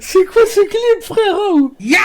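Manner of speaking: high, loud laughing squeal; laughing shriek. Real, not acted. Shrieking at a funny clip.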